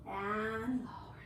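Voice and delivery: breathy whispered cue; whispering. Real, not acted. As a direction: whisper it, slowly. Coach cueing the final rep.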